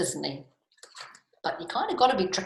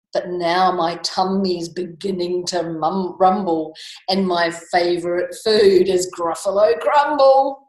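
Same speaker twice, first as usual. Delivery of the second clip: loud, deliberate storybook boast; bold, measured menace; threatening mouse; 7 dB louder than usual. Acted. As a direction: punch out the threat with relish. Mouse threatening to eat Gruffalo.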